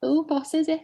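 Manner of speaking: put-on high-pitched playful Scouse accent; cheeky Liverpool Scouse voice. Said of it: "Your Scouse accent is not too bad".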